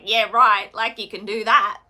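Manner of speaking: put-on loud, scoffing Australian accent; mock a doubting uncle in an Australian accent. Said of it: "with my Australian accent".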